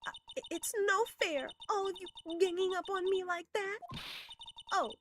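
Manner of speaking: high, put-on whine of a spoiled girl; the witness whines that everyone gangs up on her; whiny girly witness. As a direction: play the wounded innocent, pitched well up. Witness plays the victim.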